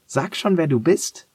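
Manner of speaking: hoch und drängend; ungeduldige Frage. Acted. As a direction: fordere die Antwort ungeduldig. Fordert die Stimme heraus. (ungeduldig und hoch, fordernd)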